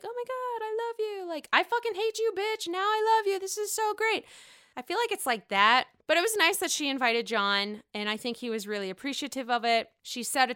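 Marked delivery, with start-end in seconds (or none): 0.0-4.2 much higher mock girly gush, love then hate; high sing-song mock of friends flipping from love to hate; mock catty friends. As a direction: squeal it like fake best friends. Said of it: "they're like".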